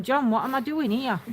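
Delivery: ordinary talk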